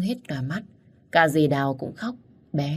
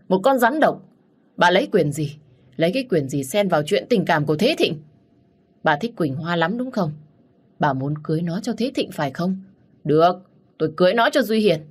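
furious shout; 2 semitones higher, 3 dB louder than usual. Acted. Father berates his scheming wife, furious shouting. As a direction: roar at her in rage. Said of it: "run rẩy và quát lớn".

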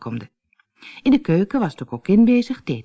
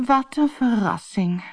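slow, higher-pitched weary sigh; sighing, bored; 7 semitones higher, slower than usual. A narrator's reading. Gerda the bored guest to her hostess, slow sigh. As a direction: sigh it out slowly, unimpressed. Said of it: "zuchtte Gerda".

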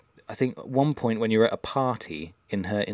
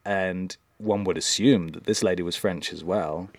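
ordinary talk

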